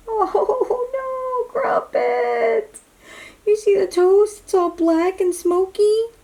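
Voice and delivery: high, dismayed mother's voice; a mom fretting over the burnt toast; upset mom. Acted. Mother dismayed at the damage, high and upset.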